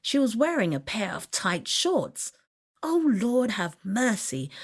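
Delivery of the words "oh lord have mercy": high start, mild pleading exclamation; a touch of alarm, appealing upward to the Lord; inward cry of dismay. A narrator's reading. Boy crying out inwardly as girl sits on lap, mild dismay. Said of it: "I cried aloud in my mind".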